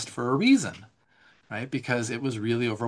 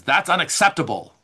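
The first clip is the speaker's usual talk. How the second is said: higher, louder stern rebuke; stern dad; 6 semitones higher, 6 dB louder than usual. Retold or imitated. Dad scolding his kid, louder and stern. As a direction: lay it down like a stern father. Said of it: "that tone of voice".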